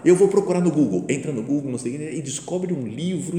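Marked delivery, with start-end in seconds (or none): 0.0-1.0 higher, louder burst of retold enthusiasm; excited boy imitation. Grandson fired up about the research, mildly excited. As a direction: lightly imitate an eager kid. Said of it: "o moleque se empolga com o negócio".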